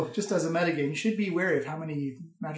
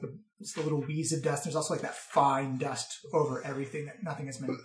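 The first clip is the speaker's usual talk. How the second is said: usual speaking voice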